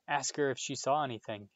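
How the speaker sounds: usual speaking voice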